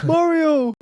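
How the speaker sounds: very high, loud playful cry; mock excited shout. Podcaster joking about Mario showing up, high mock shout. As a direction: call the name out high, hammed-up. Joking call of character's name.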